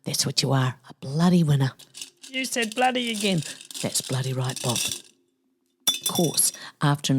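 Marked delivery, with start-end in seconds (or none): 2.3-3.4 very high, softer cartoon voice; high soft sidekick voice. Bob teases the host for swearing, high and soft. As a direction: tease in a tiny voice.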